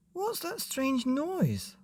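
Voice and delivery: put-on high, slow puzzled child; high slow child asking; curious child. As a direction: wonder aloud like a little boy.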